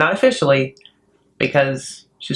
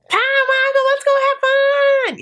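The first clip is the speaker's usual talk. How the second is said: much higher, bubbly, slower sing-song; sudden sweet switch; slower than usual. Retold or imitated. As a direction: flip instantly to sugary cheer. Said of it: "It's a switch".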